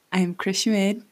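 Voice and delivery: ordinary delivery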